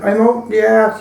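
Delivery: farmer calling out. Retold or imitated. Farmer calls out at the door.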